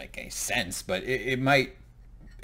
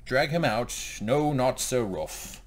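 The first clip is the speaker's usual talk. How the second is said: bossy commander; 5 semitones higher, 4 dB louder, slower than usual. Pretend. Voicing Tyrion ordering his men.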